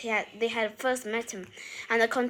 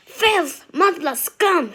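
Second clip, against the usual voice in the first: shrieking, much higher and louder; screaming insults; 6 semitones higher, 8 dB louder, much slower than usual. A narrator's reading. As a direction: hurl each word like a shriek of hate. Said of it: "she screamed after them".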